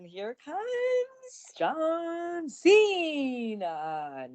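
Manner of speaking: high, long drawn-out announcer call; hyped entrance call. Acted. Podcaster hailing John Cena's surprise arrival, drawn-out announcer call. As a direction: stretch every word like a ring introduction.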